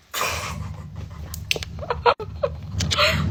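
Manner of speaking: a high, breathless burst of laughter; high airy laugh. Real, not acted. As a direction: laugh it off in embarrassment. Laugh after the embarrassment.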